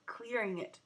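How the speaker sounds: ordinary delivery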